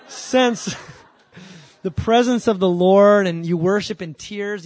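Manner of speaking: loud, laughing through the praise. Real, not acted. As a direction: laugh out the joy of worship. Laughing about God's presence.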